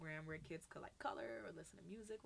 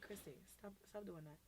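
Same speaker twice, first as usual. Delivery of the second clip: calm correction; 9 dB quieter than usual. Shown as a demonstration. Adult calmly correcting a child, softly. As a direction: say it gently, well below a yell. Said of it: "you can be like".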